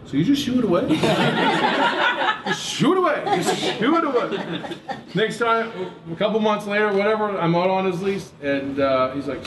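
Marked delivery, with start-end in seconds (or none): 0.1-4.8 amused repeating. Retold or imitated.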